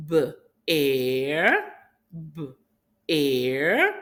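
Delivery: B-air split into b and air, slowly; B-air drawn out in two parts; slow segmenting. Shown as a demonstration. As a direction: stretch out B-air sound by sound. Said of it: "Let's say it slowly".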